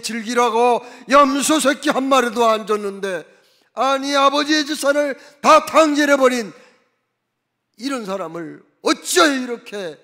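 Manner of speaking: loud, indignant son confronting his father; elder son loudly accusing his father; angry protest. Retold or imitated. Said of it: "막 큰 소리로 따지는 거예요".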